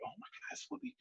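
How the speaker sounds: awed whisper; starstruck whisper. Retold or imitated. Boy sees Whoopi Goldberg walk by, whispering in awe. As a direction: breathe it out in awe. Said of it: "I'm, like, flipping out".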